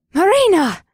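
sharp shouted name; calling out. Acted. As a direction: call her name out loud. Siren calls out sharply.

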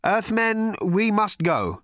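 nervous. A narrator's reading. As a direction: urge them to leave, uneasily. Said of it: "said Slarty Bartfast nervously".

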